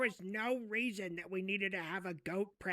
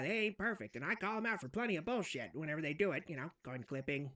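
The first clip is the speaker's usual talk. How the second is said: usual speaking voice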